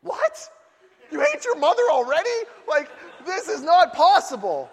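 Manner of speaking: high, loud disbelief; mock shock. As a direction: act stunned. Shocked parent reaction.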